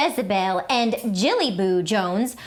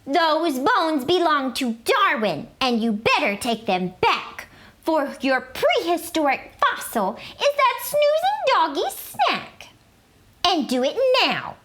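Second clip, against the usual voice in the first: a higher, scolding mother's voice; stern mother; 6 semitones higher than usual. A narrator's reading. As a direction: order him firmly, like a mom. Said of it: "ordered Mama".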